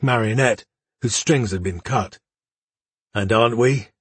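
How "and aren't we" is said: bitter, inward thought. A narrator's reading. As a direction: say it bitterly to yourself. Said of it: "he thought bitterly".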